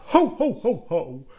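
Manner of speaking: high, loud crazed Santa laugh; maniacal ho ho ho. A narrator's reading. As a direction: cackle it like a mad Santa. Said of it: "maniacal laugh".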